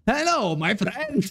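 high, hammed-up vendor greeting; mocking accented English greeting; imitated game vendor. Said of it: "il va me dire".

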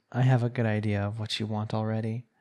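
ordinary talk

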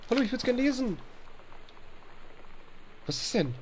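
ordinary delivery

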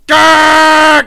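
loud high gah; frustrated yell. Real, not acted.

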